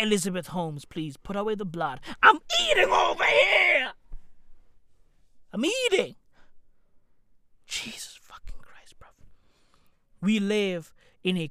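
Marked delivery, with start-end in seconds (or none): 2.2-6.2 high, whining mock cry; hammed-up complaint while eating; mock wail. Mock plea to Holmes. 7.7-9.1 hushed whisper; exasperated whisper; whispered curse. Real, not acted. Aside after the blood joke.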